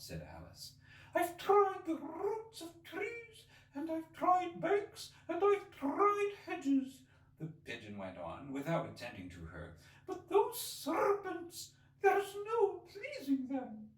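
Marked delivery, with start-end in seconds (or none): 1.1-7.0 very high, slow, fretful bird voice; lamenting pigeon. Acted. Pigeon ignoring Alice, fretful and aggrieved. 10.1-13.9 very high, slow, fretful bird voice; lamenting pigeon. Acted. Pigeon ignoring Alice, fretful and aggrieved.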